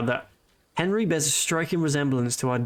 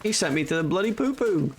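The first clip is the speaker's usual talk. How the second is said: higher-pitched mock outrage; mock indignation; 6 semitones higher than usual. Player grumbling about being sent to the privy, mock indignant. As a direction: complain in playful disbelief.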